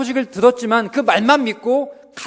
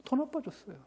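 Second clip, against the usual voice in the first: 더 said high, the rest fades to near whisper; hushed echo; 7 semitones higher, 16 dB quieter than usual. Shown as a demonstration. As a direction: echo 더 나빠졌어요 softly, crushed. (더 나빠졌어요를 힘없이 낮게)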